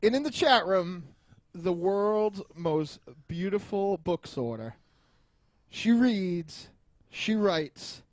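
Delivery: big, loud ring-announcer build-up; announcer introduction. Pretend. Announces the chat room guest.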